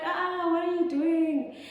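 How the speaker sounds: high, loud, drawn-out cry of alarm; panicked scream. Retold or imitated. Woman to the undressed guy in the room, panicked scream. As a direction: scream it in mock panic, stretching the words. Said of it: "now I started really panicking".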